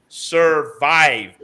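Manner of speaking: stressing Survive again for the audience. Shown as a demonstration. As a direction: bark Survive as a one-word warning. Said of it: "I'm gonna remind you of that word".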